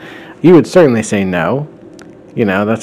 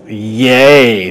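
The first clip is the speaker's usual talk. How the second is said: drawn-out, louder mock cheer; sarcastic; 2 semitones higher, 7 dB louder than usual. Chris the rude baby greets the lunch guest, sarcastic. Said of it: "sarcastically, 'cause he's a rude baby".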